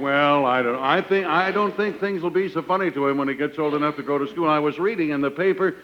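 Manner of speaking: normal talking voice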